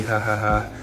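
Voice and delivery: normal talking voice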